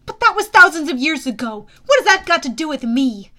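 high, loud objection; indignant protest. Acted. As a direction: defend yourself against unfair blame. Hero protests the accusation.

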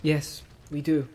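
slow, mournful admission; sad. Acted. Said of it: "said Pippin sadly".

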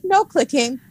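high-pitched mock order; mock scolding. Friend teasing her old roommate about the clicking rule, mock scolding. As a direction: playfully imitate a bossy roommate.

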